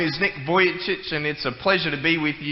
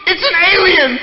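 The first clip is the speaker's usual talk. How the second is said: put-on very high, loud little girl's voice; a child pointing out an alien in alarm; little girl calling mommy; 13 semitones higher, 7 dB louder than usual. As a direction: squeal it to mommy like a scared small girl. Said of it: "and she goes".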